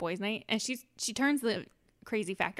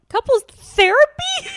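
very high, loud, slow disbelief; can't believe what you heard; incredulous shriek; 16 dB louder, slower than usual. Real, not acted. Host reacting to the couples therapy advice, high shrieking disbelief.

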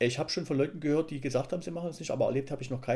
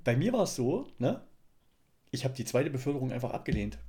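ordinary delivery

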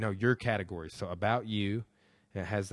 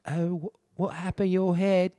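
mom's voice; 11 semitones higher, 9 dB louder than usual. Retold or imitated. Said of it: "And her mom's like".

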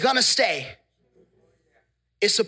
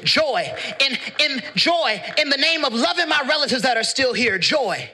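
driving sermon, each "Joy" launched hard; full-force preaching; 5 semitones higher, 3 dB louder than usual. Real, not acted. Preacher rallying a grieving church with joy, full-force preaching. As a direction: preach it in relentless, building refrains.

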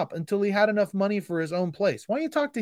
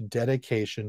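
usual speaking voice

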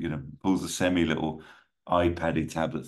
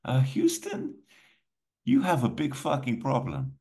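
high-pitched theatrical villain; villain's taunt; 9 semitones higher than usual. Acted. Villain on the big screen taunting nasa, high theatrical voice. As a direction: pitch it up high and savour the threat.

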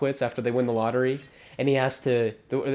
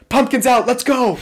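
excited call; 8 semitones higher, 4 dB louder than usual. Retold or imitated. Kevin rallying coworkers to leave.

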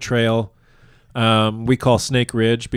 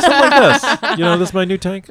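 laughing, higher and louder, showing off; laughing quote; 8 semitones higher, 5 dB louder than usual. Retold or imitated. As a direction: giggle while presenting it. Showing off nicest workout tank.

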